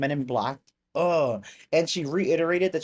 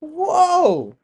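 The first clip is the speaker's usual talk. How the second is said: high, loud, drawn-out whoa; amazed whoa; 13 semitones higher, 9 dB louder than usual. Real, not acted. Streamer amazed by the UFO footage, loud and long. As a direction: let the wonder out.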